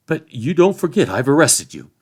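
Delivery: high, loud insistence; officious sheriff. A narrator's reading. Sheriff leader reminding Frodo he's arrested, officious insistence. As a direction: assert authority with bluster.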